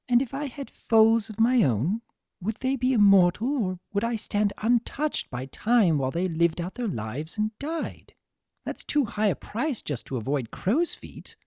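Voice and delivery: grave reflection, elegant; lightly voiced somber Rarity.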